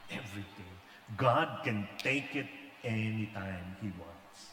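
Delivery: quiet, humble sermon voice; sincere preaching. Acted. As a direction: speak humbly from the heart. Preacher turns to sincere message.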